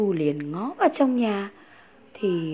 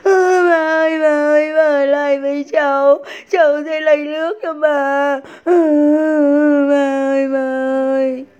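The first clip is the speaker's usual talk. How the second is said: higher and louder, sobbing pleas; crying child; 7 semitones higher, 9 dB louder than usual. A narrator's reading. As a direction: beg grandma to stay through tears. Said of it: "vừa chạy vừa khóc".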